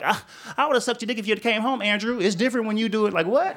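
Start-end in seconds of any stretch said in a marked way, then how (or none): 0.0-2.2 high, hurt wife voice; betrayed wife. Retold or imitated. Wife finding out online, high and hurt. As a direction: play the jilted wife, pitched up.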